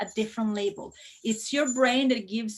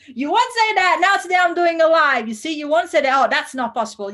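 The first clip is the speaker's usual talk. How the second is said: louder, higher mock protest; imitating her fearful self; 4 semitones higher, 7 dB louder than usual. Teacher imitating her scared student self, mock protest. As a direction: play your old anxious self refusing. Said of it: "So I was like that".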